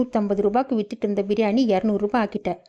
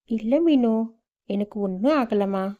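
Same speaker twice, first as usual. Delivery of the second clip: ordinary talk, nothing marked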